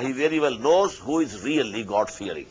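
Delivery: ordinary talk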